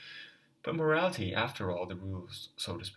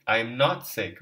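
loud, sharp denial; cried out; 2 semitones higher, 10 dB louder than usual. Acted. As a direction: shout the denial. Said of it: "Raskolnikov cried out".

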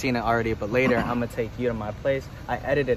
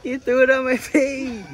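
higher, helpless laughing complaint; real laughing protest; 12 semitones higher, 3 dB louder than usual. Real, not acted. Friend laughing after getting hit in the face, laughing protest.

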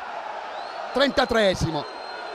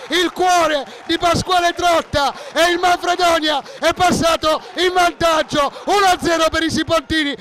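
breathless italian goal commentary; 7 semitones higher than usual. Real, not acted. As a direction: scream the goal call, then ride it down. Goal scored, commentator erupts.

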